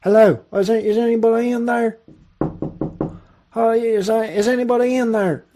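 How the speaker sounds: imitating a tourist knocking at the booth; tourist calling in. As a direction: call into an empty booth looking for someone. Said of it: "All of a sudden I hear".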